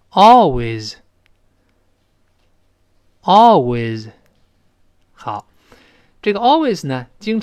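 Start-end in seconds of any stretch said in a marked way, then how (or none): 0.1-4.1 slow, loud model of "Always"; model reading. Shown as a demonstration. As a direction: say "Always" stretched on a strong first syllable for students to repeat. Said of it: "跟老师读一遍". (领读“Always”，重读拖长前音节)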